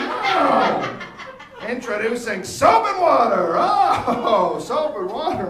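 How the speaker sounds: loud, high hammed-up ad pitch; infomercial announcer. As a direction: sell soap like a TV pitchman. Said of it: "some infomercial going".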